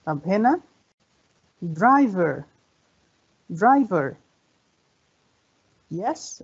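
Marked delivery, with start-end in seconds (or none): 1.6-2.4 "driver" as DRI-ver, falling; stress on first syllable. Shown as a demonstration. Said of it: "The first syllable". 3.5-4.2 "driver" as DRI-ver, falling; stress on first syllable. Shown as a demonstration. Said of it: "The first syllable".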